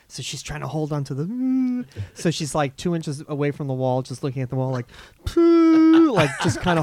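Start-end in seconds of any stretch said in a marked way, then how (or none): 5.2-6.1 a high, loud mocking sob-puff; a hammed-up burst of held-back crying; mock crying breath. Mocking an actress's crying jag with a high, loud puff. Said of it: "holding this, like, crying jag".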